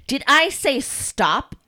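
loud, high, drawn-out rebuke; yelled. A narrator's reading. Mistress catches Carl stopping, yelling. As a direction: yell it slowly at a disobedient servant. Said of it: "she yelled".

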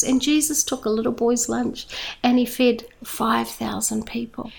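ordinary talk

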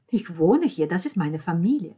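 loud, defensive retort; indignant. Acted. Lucia defends being at her family's house, indignant. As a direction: push back forcefully.

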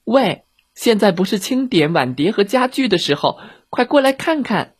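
put-on high, urgent child's voice; calling little girl. Girl calls bears from other room.

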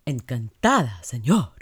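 phrase-book Spanish, no personality; stiff, dramatic telenovela narrator. Shown as a demonstration. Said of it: "sound like a telenovela narrator".

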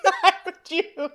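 high, slow, laughing; laughing disbelief. Real, not acted. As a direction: laugh through the question. Laughing at absurd friend.

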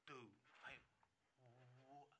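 imitating buddies' low, hushed aside; very soft and halting, as baffled friends; acted puzzled undertone; hushed confusion.

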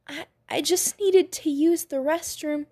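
timid, stammering woman's voice; hesitant and scared. A narrator's reading. Frightened woman stammers excuse.

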